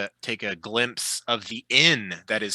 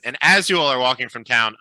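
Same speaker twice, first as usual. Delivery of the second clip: ordinary talk, nothing marked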